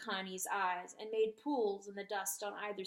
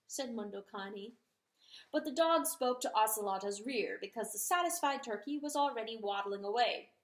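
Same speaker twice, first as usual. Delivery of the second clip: ordinary delivery, nothing marked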